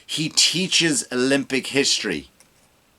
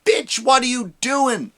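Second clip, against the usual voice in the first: high, slow exasperated yell; exasperated retort; 11 semitones higher, slower than usual. Retold or imitated. Comic imagining someone confronting Kamala, high slow exasperated yell. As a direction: drag it out high in disbelief. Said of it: "Nobody goes".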